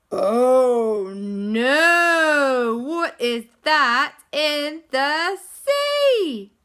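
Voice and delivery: gasp, then loud crying oh no; frightened gasp. Acted. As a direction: cry out in fright, slowly. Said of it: "Auntie Lisa peered out the window and went".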